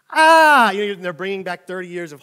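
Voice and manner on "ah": loud high wail of horror; mock anguished cry. Seatmate shown the crash video, loud mock wail. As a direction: cry out as if old memories flood back. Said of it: "And they're like".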